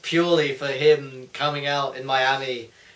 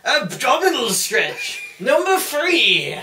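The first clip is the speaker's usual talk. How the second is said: high, loud, slow, drawn-out countdown; milk each number like a showman; Jericho list promo; 8 semitones higher, 6 dB louder, much slower than usual. Retold or imitated. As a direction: belt out the list to a crowd. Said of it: "I love his promo".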